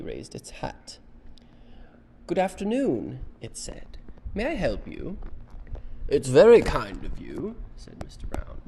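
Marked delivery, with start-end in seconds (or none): 2.3-3.2 higher, louder, courteous greeting; polite little bear. Acted. Bear greets the Browns, polite and higher. 4.3-5.2 higher-pitched courteous offer; polite little bear. Acted. Bear offers Browns help, polite and higher. 6.1-7.6 loud, hearty storybook gentleman; courteous Mr Brown. Acted. Mr Brown answers the bear, loud and courteous.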